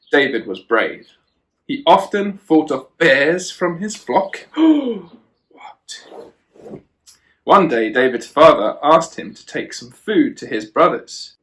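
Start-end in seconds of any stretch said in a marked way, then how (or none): 4.5-5.0 sharp high theatrical gasp; playful storyteller's gasp; mock gasp. Mock shock at bear fighting. 5.5-6.8 breathy whispered what; hushed playful aside. Pretend.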